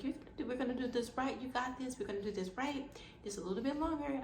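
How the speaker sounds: calm her patiently; reassuring. Retold or imitated. Doctor to patient asking how long, reassuring.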